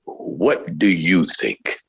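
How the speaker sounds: low and slow; slow low producer voice. Shown as a demonstration. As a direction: drop low, drawn out. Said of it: "remember is this one phrase".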